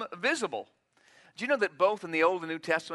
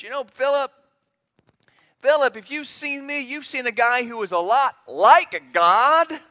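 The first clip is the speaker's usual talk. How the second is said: higher, louder, breezy brush-off voice; casual hypothetical Jesus; 6 semitones higher, 6 dB louder than usual. Pretend. Preacher voicing a downplaying Jesus to Philip, mock casual. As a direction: play it down like it's no big deal. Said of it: "He could have said that".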